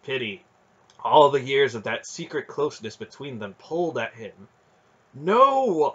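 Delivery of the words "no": high, loud refusal; stern command. A narrator's reading. Aunt's stern voice stopping the boy, commanding. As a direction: forbid it outright. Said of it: "Aunt Paul's stern voice commanded".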